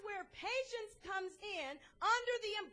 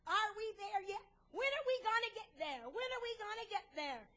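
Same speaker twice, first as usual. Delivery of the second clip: put-on higher, nagging child's questions; pestering little boy; 4 semitones higher than usual. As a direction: imitate a small boy pestering his mother. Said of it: "He kept asking his mother".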